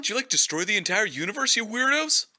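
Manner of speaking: high, indignant Spaceman; annoyed Spaceman voice. Retold or imitated. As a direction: accuse them in a high, indignant voice. Voicing the Spaceman.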